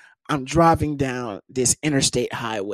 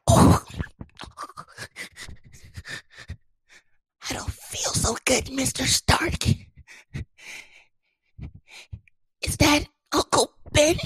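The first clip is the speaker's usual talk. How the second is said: higher, weak hammed-up dying voice; mock fading Spider-Man; 12 semitones higher than usual. Joking he is vanishing after rejection, mock weak voice. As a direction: fade out like a movie hero. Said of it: "I'm fading away from existence".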